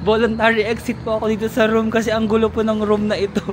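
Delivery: retold excuse with laughter; laughing. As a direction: giggle while explaining the exit. Laughing at her own joke.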